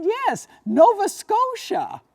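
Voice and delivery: high and drawn out; high, slow woman voice. Retold or imitated. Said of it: "the woman said".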